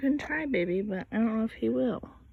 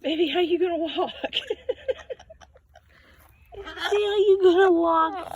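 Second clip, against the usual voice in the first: playful teasing; 8 semitones higher, 4 dB louder than usual. Real, not acted. Teasing the child about walking.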